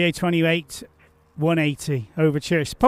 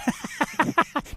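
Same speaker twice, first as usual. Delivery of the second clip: airy high giggle; giggly laugh; 8 semitones higher, 5 dB quieter than usual. Real, not acted. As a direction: giggle at the joke. Laugh at "love you.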